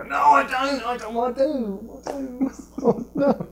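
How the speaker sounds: put-on high, stammering child voice; mock panicked schoolboy; flustered kid denying. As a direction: deny it then cave, flustered. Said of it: "They go".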